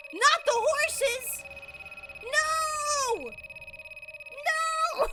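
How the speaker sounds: high, loud, drawn-out cry of despair; anguished yell. Acted. Osawa crying out at the endless noise, loud and high. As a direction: scream it like losing your mind.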